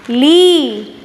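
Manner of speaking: "ली" with a long, sustained ee; long-vowel demonstration. Shown as a demonstration. Teacher joining ल and ई matra, "ली" drawn out long. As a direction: hold the vowel of "ली". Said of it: "ध्वनि आएगी वो कैसी आएगी".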